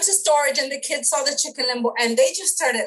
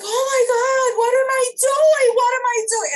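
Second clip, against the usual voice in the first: panicked exclamation; 14 semitones higher, 7 dB louder than usual. Retold or imitated. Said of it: "I'm like".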